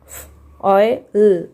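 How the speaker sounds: "फ ओ ए एल" split into slow separate sounds. Shown as a demonstration. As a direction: sound out each part of "foil" slowly. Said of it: "foil". (धीरे-धीरे ध्वनि-दर-ध्वनि)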